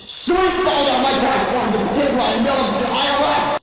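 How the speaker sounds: loud, high scream. Acted. Teacher screaming about her tax form, loud and high. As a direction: shriek it in pure outrage. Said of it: "screamed Miss Lightboard".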